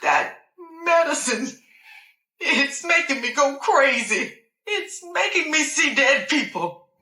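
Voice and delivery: crying out that the medicine is driving her mad. Acted. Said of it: "I couldn't help but cry".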